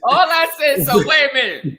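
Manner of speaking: high, loud interjection over laughter; loud protest. Real, not acted. Host objecting as co-host laughs at his words, loud protest. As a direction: raise your voice to cut in and object.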